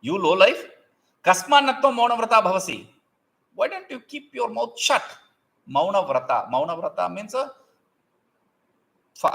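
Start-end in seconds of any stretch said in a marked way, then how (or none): 0.0-2.9 raised, louder scolding as the monkey; the monkey rounding on the bird in anger, with a raised scolding voice; angry monkey. A narrator's reading. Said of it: "with anger". 3.5-5.0 angry retort. A narrator's reading. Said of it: "with anger".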